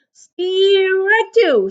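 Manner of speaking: high, loud umpire call; loud high umpire shout; umpire's shout. A narrator's reading. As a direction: call the strike across the field. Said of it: "shouted the umpire".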